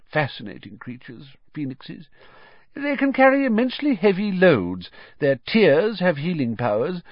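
put-on fond, animated elderly wizard; Dumbledore, admiring. A narrator's reading. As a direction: savour each quality of a beloved pet.

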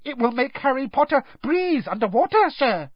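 high-pitched elf voice; squeaky earnest house elf; Dobby. A narrator's reading. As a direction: explain eagerly in a squeak. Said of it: "squeaked the elf".

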